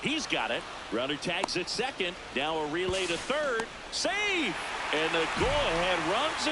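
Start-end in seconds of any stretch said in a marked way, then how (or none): 3.9-4.5 sudden very high one-word burst; excited safe call. Real, not acted. Announcer calls a close relay play at third, excited. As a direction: burst out with the umpire's verdict.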